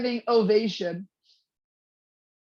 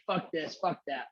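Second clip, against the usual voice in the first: low, quieter gruff cussing impression; imitating cussing Ron; 7 semitones lower, 7 dB quieter than usual. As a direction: mutter the curses like a grumpy actor. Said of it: "he's cussing up a storm".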